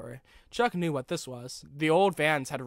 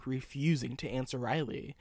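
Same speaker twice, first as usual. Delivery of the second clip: ordinary delivery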